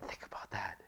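breathy whispered aside; hushed whisper. Real, not acted. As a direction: whisper it to them.

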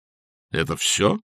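slow, baffled question; taken aback. A narrator's reading. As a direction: ask it slowly, at a loss. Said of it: "обескураженно спросил".

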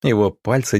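ordinary delivery

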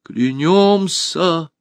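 high, long-drawn collective cry; crowd's oath. A narrator's reading. Hall swearing loyalty to Alisa, high drawn-out cry. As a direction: stretch the oath out as a crowd would. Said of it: "прокатилось по залу".

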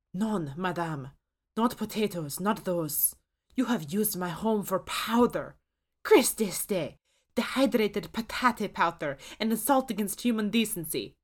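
put-on thick French accent, outraged; a French chef appalled, in French accent. A narrator's reading. Ghost chef scolds Susan about potato powder, thick French accent. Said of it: "The chef's accent is thickly French".

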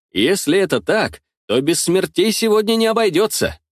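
usual speaking voice

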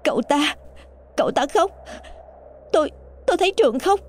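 high, fast, breathless with fear; terrified stammer. A narrator's reading. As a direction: stammer it out in panic. Terrified Thanh stammers after seeing ghost.

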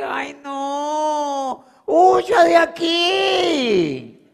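agudo, fuerte, estirado y lloroso; grito asustado fingido. Retold or imitated. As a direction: huye espantado, exagerando en broma. Imita al prospecto asustado. ("huya de aquí" chillado con susto fingido)

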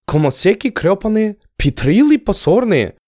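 entranced, puzzled echo of the words; bewildered repeating. Acted. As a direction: repeat it spellbound, not understanding. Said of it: "завороженно непонимающе повторил Аскел".

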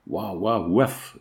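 slow, mock dog bark in words; half-hearted howl. A narrator's reading. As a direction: woof slowly like a lightly voiced dog. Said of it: "hurla-t-il".

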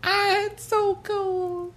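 very high, slow, soft dying squeak; tiny creature voice. Retold or imitated. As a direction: drag it out like a freezing little creature. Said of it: "he's like".

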